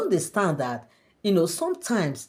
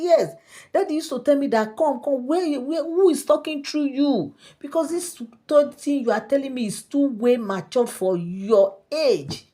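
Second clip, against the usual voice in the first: high, insistent acted disbelief; pitched-up amazement in a retold dialogue; incredulous questioning; 8 semitones higher, 4 dB louder than usual. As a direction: press the child, astonished, voice raised in pitch. Queen presses child in disbelief.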